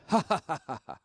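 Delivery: a bouncing laugh from the belly. Shown as a demonstration. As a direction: laugh to show the diaphragm bounce. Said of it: "kinda like I'm laughing".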